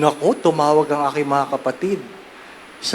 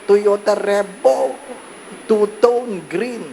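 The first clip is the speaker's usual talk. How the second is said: higher, slow sweet sales pitch; eager salesman; 7 semitones higher than usual. Retold or imitated. Car seller persuading the priest, sweet high pitch. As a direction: coax him into the new car.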